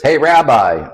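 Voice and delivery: a loud hail across the street; calling out. Retold or imitated. Man outside a liquor store calls to the rabbi, loud hail. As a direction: call out to get the rabbi's attention.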